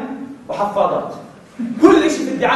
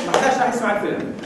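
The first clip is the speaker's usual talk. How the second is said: ordinary talk, nothing marked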